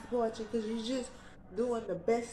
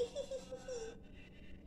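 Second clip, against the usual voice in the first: embarrassed high-pitched giggle; high giggle after a burp; 13 dB quieter than usual. Real, not acted. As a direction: giggle high and soft at your own burp. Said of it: "It's so unladylike".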